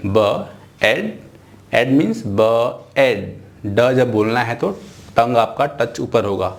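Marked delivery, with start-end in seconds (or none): 0.1-1.2 "ब" then "एड", very slowly; slow sounding out. Shown as a demonstration. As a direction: drag the blend out for the class. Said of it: "sound कैसे बोलना है आपको?". (बहुत धीरे "ब एड" जोड़कर बोलें)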